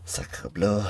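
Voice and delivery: whispered French exclamation; french chef. Pretend. Exasperated at colonists.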